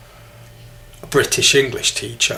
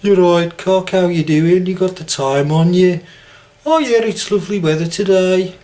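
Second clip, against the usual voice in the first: higher, louder mock Brummie; Birmingham accent; 6 semitones higher, 4 dB louder than usual. Shown as a demonstration. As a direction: put on a sing-song Birmingham accent. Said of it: "the second half speak like".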